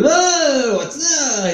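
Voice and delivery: shocked onlookers. Retold or imitated. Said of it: "people go".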